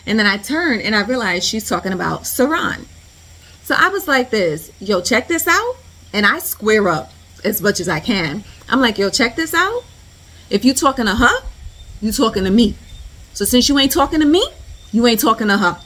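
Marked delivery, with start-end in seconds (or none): 4.8-5.8 higher-pitched squaring-up challenge; tough challenge. Retold or imitated. Narrator steps up to the woman, tough challenge. 9.0-15.8 loud, higher, squaring up in challenge; tough confrontation. Retold or imitated. Narrator defends her friend from the woman, tough confrontation.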